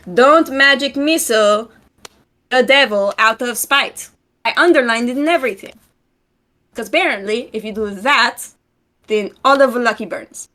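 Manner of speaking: ordinary delivery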